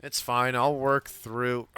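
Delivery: normal talking voice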